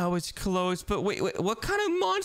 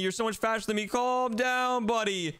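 normal talking voice

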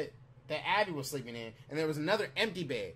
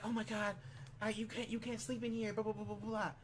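softer, higher imitation of a flustered woman; mock freak-out; 3 semitones higher, 8 dB quieter than usual. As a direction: mimic her fussing in a small, light voice. Imitating her morning freak-out.

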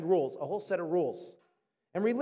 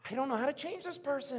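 higher-pitched acted helplessness; exasperated; 5 semitones higher, 4 dB quieter than usual. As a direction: throw it out in mock exasperation. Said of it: "they don't do it, you're like".

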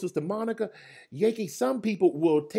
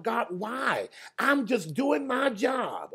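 high, slow plea to God; pleading lament; 9 semitones higher, slower than usual. Retold or imitated. Servant cries up to God, higher and slow. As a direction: stretch it out high like a hurt servant asking why. Preacher voices complaining servant.

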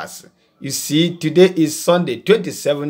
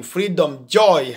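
ordinary delivery

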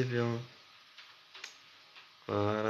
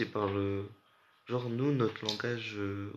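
ordinary talk, nothing marked

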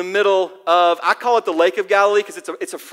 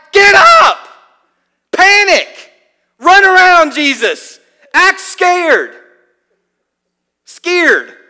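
loud, much higher panicked yelling; frantic shout; 9 semitones higher, 8 dB louder, much slower than usual. Retold or imitated. Panicked disciples yelling at sleeping Jesus to wake up. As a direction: shout orders in a storm, half-mock. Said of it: "I picture when the disciples woke him up".

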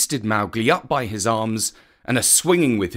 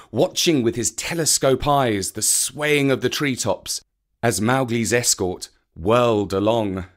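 plain reading voice

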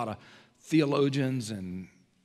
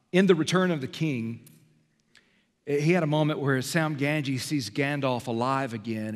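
ordinary talk, nothing marked

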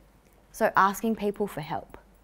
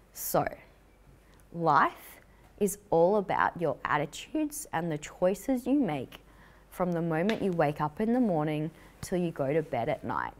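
usual speaking voice

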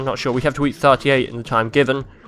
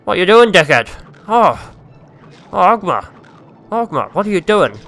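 higher, louder exasperated telling-off; hammed-up indignant reproach; mock scolding; 5 semitones higher, 5 dB louder than usual. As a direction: play someone berating the player. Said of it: "slap me about and be like".

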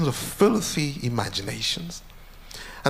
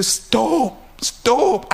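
pitched up, louder cry of stop; pleading stop; 8 semitones higher, 3 dB louder than usual. Retold or imitated. Preacher begging the Lord to stop, rising plea. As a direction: beg him to stop, voice climbing.

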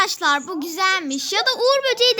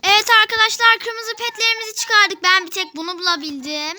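ordinary talk, nothing marked

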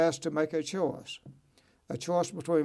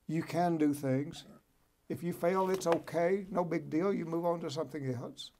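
normal talking voice

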